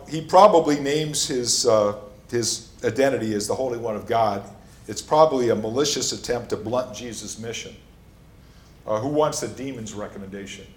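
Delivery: usual speaking voice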